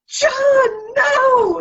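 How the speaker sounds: high, loud mock scold; shocked scolding. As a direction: rein in your brother, shocked. Scolding brother in the line.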